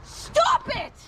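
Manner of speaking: high, frightened shout; scared and angry shout. Real, not acted. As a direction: shout him off in fear. Woman shouting at him.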